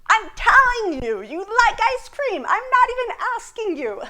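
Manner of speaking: high, loud mock insistence; playfully insist, pitch swooping high, laugh at the end; hammed-up bossy statement breaking into a laugh; emphatic telling. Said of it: "I would be making a statement".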